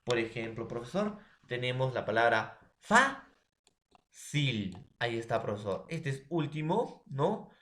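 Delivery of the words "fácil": slow two-beat demo of "fácil"; stress on penultimate syllable. Shown as a demonstration. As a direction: punch the FÁ of "fácil", then let CIL follow slowly. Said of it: "penúltimo".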